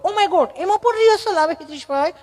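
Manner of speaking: high, incredulous prissy voice; mock a city visitor astonished at needing rehearsal; affected city speaker. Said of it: "ચીપી ચીપીને Gujarati બોલે".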